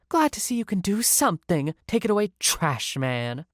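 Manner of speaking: high-pitched snide guest, slowing at the end; condescending. Acted. Guest dismissing the worker clearing trays, condescending. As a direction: sneer it high, then drag the insult.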